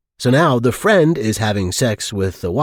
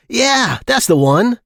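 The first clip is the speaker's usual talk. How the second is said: eager yes; 11 semitones higher than usual. Acted. Man eagerly confirms small-penis book.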